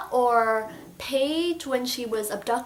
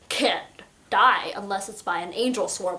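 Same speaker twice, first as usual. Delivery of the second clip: ordinary delivery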